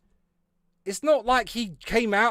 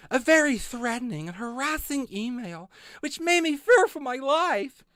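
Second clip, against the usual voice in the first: mocking read-aloud; 11 semitones higher than usual. Shown as a demonstration. Reading out a complaint to chat in a high mocking voice. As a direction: read it like a melodramatic victim, much higher. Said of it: "let me read this again".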